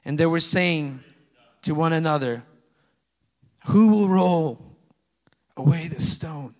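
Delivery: loud, slowing proclamation of the angel's words; preaching scripture. Acted. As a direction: proclaim it to the whole church. Reading scripture.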